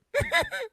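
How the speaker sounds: high put-on eerie laugh; retold spooky laugh; imitated creepy laugh. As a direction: mimic a ghostly laugh from behind. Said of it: "I heard like that".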